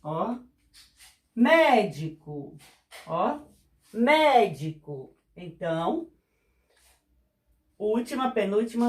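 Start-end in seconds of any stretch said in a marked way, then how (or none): 1.3-2.6 médico said slowly, leaning on the mé; stress on first syllable. Shown as a demonstration. Teacher demonstrating a proparoxytone word, slow stress on mé. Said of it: "Por exemplo, a palavra, ó". 3.9-5.2 médico said slowly, leaning on the mé; stress on first syllable. Shown as a demonstration. Teacher demonstrating a proparoxytone word, slow stress on mé. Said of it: "Por exemplo, a palavra, ó".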